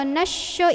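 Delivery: "nasya" with a hushing sh in place of s; shin sound. Shown as a demonstration. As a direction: say "nasya" with a held sh. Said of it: "itu huruf shin".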